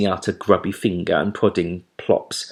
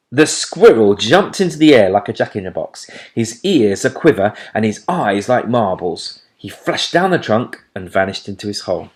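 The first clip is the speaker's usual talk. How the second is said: plain reading voice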